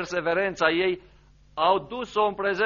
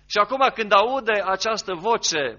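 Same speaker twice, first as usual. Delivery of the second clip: ordinary talk, nothing marked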